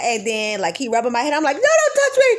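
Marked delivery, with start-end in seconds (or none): 1.6-2.3 high loud laughing shout; laughing yelp. Retold or imitated. Wife in labor shoving off husband rubbing her head, loud laughing yell. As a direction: bark it through a laugh.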